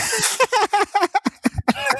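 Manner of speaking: high, squealing laugh bursting out; burst of laughter. Real, not acted. Laughing hard at her own Matrix bullet story.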